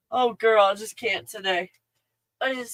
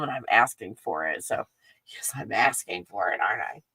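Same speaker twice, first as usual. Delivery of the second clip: lower, breathless whisper with a sly wink; whispered aside; 8 semitones lower, 4 dB quieter than usual. Real, not acted. Vlogger slyly whispers to viewers that she's asking for products. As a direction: let them in on the secret, whispered.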